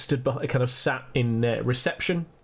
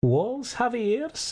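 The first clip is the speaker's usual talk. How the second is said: put-on high, knowing Scottish old gent; hint at secrets slyly, Scottish; Scottish accent, high and sly; 10 semitones higher, slower than usual. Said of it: "he says".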